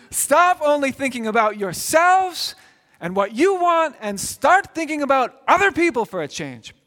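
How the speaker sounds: put-on dad turns around and yells at the complaining kids; exasperated dad yelling. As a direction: snap at the kids from the front seat. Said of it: "the tone of voice in which he says it".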